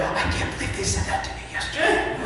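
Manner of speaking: high, hushed, quick panicky squeak; acted fear. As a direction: imitate an anxious mind spiralling, soft and rushed. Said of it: "Right, that fear, that's what it sounds like".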